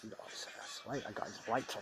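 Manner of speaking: whisper. Real, not acted. Hushed late aside.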